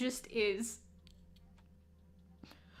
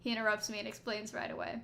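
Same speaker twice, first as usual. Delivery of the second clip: normal talking voice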